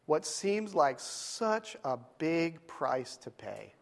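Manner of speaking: slow, hushed sermon delivery with long pauses; solemn preaching. Real, not acted. Preacher telling church what God asks, solemn preaching. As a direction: let the moral sink in, phrase by phrase. Preaches the moral after story.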